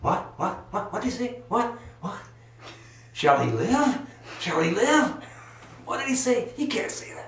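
high, incredulous cries of confused bystanders; a hammed-up chorus of outraged gossips; bewildered onlookers. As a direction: play shocked listeners who can't believe what they heard. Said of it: "they hear this conversation going".

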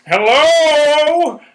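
loud, high showman hello; stage greeting. Acted. Henry greeting the audience, loud and high. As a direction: burst onto the stage.

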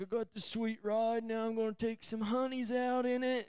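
high-pitched imitation of a show-off; bragging teenage friend. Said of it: "He's like".

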